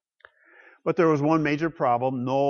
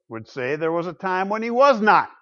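ordinary talk

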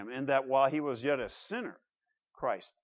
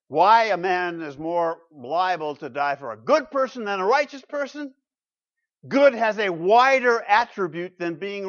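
raised, louder preaching voice; rising pulpit emphasis; 5 semitones higher, 9 dB louder than usual. Acted. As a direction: press the point home from the pulpit. Preacher builds the point.

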